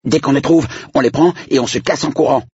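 put-on high, quick kid voice; high-pitched hurried plan; scheming young boy. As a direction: propose it urgently, like a child plotting. Boy proposes a plan to friends.

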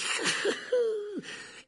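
very high, falling cackle; mocking villain cackle. Teacher mocks the werewolf, high cackle. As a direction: cackle derisively, high then dropping.

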